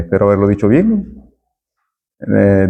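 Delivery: normal talking voice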